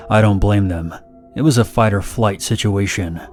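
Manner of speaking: ordinary delivery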